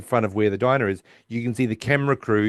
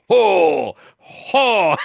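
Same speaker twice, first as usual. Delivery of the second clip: much louder, higher ho ho; acoustic test calls; 9 semitones higher, 7 dB louder than usual. Retold or imitated. Sheldon testing acoustics from the top, loud and high. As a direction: boom out ho ho to test the room. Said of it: "you hear him go".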